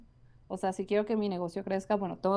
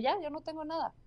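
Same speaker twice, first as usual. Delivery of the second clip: mock carefree; 7 semitones higher, 6 dB quieter, faster than usual. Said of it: "hay personas que les pasa de".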